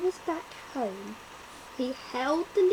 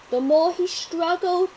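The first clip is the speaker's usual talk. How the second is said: ordinary delivery, nothing marked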